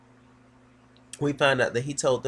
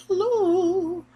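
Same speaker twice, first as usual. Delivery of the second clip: very high, drawn-out mock hello; educated caller; 4 dB louder than usual. College girl phoning Missus Mott, high and affected. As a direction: sail way up high on the greeting. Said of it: "Real educated boots".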